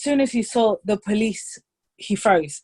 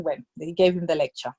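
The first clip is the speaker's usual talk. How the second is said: ordinary delivery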